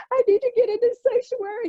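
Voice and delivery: tearful retelling. Retold or imitated. Guest recalling calling her pastor after layoff, tearful. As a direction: let the tears rise as you tell it.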